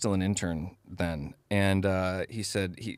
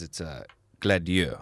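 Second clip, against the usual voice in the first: put-on French accent; 5 semitones lower, slower than usual. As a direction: quote the Frenchman saying "Gladieux" slowly. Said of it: "my terrible French accent".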